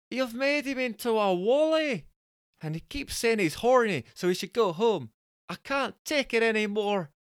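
high, frantic falsetto woman, only mildly shouted; mock-distressed high wail to the doctor; shouted complaint. A narrator's reading. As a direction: plead down the phone in a high Scottish voice. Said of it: "Jessica shouted down the phone".

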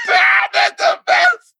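very high squeal through laughter; real hysterical laughter. Real, not acted. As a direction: squeal it out while cracking up. Cracking up at the story.

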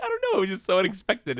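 high, light voice of the friend; a hint of the friend's high voice; imitating friend. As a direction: answer sheepishly like him. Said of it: "He's like".

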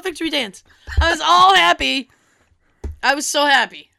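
ordinary talk

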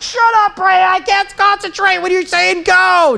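high, strained comic yelling; mock exasperated shout. Climber dad to his cheering daughter, mock exasperated shouting. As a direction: act out an over-the-top panicked retort.

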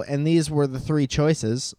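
ordinary delivery

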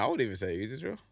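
ordinary delivery

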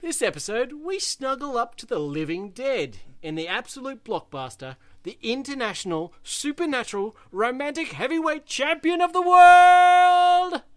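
building to a huge roaring announcement; loud carnival barker. Acted. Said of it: "hyped announcement of the book".

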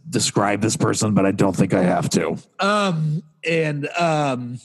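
usual speaking voice

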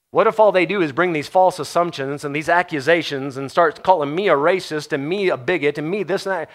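ordinary talk, nothing marked

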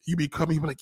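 normal talking voice

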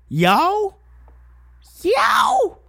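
high, loud rising calls of y'all; mock calling out. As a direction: call out high like searching for someone. Mocking the vague y'all.

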